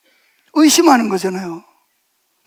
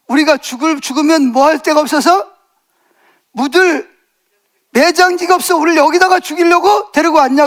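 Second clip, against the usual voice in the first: raised, whining protest thrown at Moses; complaining Israelites; 6 semitones higher, 3 dB louder than usual. Retold or imitated. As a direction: play the grumbling crowd blaming Moses. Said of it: "모세 보고 뭐라 그러죠?".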